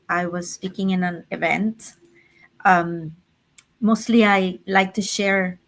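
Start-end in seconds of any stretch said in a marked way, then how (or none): none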